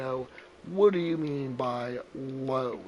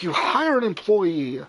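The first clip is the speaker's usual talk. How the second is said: louder, higher exasperated protest; retold indignant snap; indignant outburst; 5 semitones higher, 7 dB louder than usual. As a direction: throw the complaint out in disbelief. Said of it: "I'm like".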